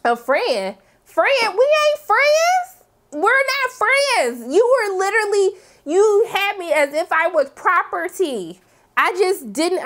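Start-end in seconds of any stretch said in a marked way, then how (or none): 1.0-1.5 FRIEND thrown back high, as a question; incredulous. Acted. Throwing Serena's word back at her, incredulous. 1.6-8.6 high, drawn-out offended retort; indignant. Acted. Acting out the captive rejecting friendship, indignant.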